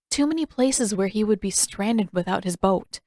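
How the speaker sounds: plain reading voice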